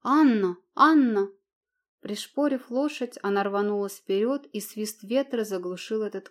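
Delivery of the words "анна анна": loud calls from far behind; Анна! called, loud and falling; calling out. A narrator's reading. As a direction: call her name across a distance. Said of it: "зовущий голос".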